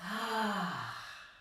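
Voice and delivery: long, open sighing breath out; demonstrated exhale. Shown as a demonstration. Yoga teacher showing the class an exhale, audible sigh. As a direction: let the breath fall out audibly through the mouth.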